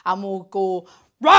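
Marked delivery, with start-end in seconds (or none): 1.2-1.3 short, loud rising pretend roar; dragon roar. Storyteller leads children in being dragons, loud roar.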